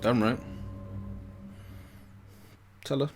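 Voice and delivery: ordinary delivery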